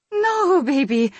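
high, loud, heartfelt denial; emotional protest. A narrator's reading. Mother reassuring son who feels hated, emotional protest.

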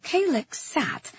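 ordinary delivery, nothing marked

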